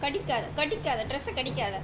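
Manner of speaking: low, soft repeated warning; quiet admonishing. Real, not acted. Owner telling the dog not to bite clothes, quietly admonishing. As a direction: warn the pet quietly, pitch dropped.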